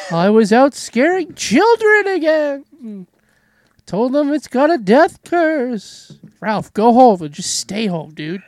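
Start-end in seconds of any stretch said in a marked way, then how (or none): 0.1-6.1 put-on slow, high creepy old man; hammed-up doom prophet; slow ominous raspy drawl. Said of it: "Ralph, where were you been?".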